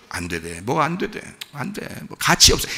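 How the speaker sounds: hopeless self-talk fading to a whisper; despairing mumble. Retold or imitated. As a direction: give up under your breath. Voicing a despairing believer.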